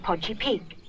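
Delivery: plain reading voice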